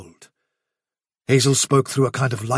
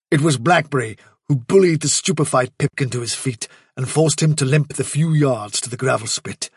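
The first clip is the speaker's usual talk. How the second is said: plain reading voice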